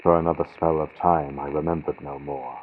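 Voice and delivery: ordinary delivery, nothing marked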